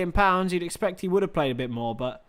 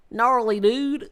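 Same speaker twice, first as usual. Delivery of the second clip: raised-pitch, put-on American dude voice; American accent; 6 semitones higher, slower than usual. Streamer showing Liz it's just American, mock American accent. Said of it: "It's just American, isn't it?".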